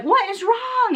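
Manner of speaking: high-pitched, urgent imitation of a worried husband; mock alarm, voice jumping up; alarmed question. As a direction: demand to know, acting out concern. Acts out alarmed husband chasing her.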